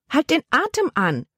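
high urgent call; aunt calling after the niece at the gangplank. Acted. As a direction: call the order after her. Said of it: "rief ihre Tante".

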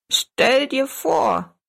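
high, slow, delighted cry; cheerful call. Acted. The little old woman delighting in the mother's recovery, calling out cheerfully. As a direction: burst out with glee. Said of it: "rief die kleine Frau fröhlich".

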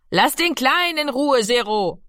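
high-pitched mother's scolding call. A narrator's reading. Mami tells Giro off for pestering the little one, calling out. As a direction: call across the room to stop him. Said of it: "rief Mami".

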